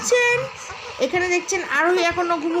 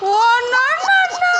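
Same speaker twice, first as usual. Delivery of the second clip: playful squeal; 10 semitones higher, 3 dB louder than usual. Pretend. Mother playfully protesting to baby.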